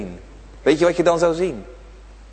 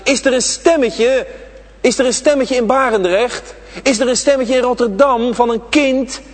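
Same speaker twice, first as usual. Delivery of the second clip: raised, searching questions to the room; preacher's questioning call; rhetorical preaching call; 7 semitones higher, 3 dB louder than usual. Acted. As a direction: call out as if listening for a child's voice.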